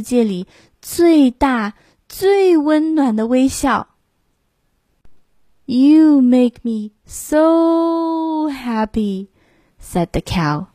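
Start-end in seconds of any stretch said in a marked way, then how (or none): 5.6-9.3 higher, very slow and tender; tender cow. Acted. Cow telling the lamb how happy he makes her, tenderly. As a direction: savour each word with heartfelt thanks. Cow's voice in story.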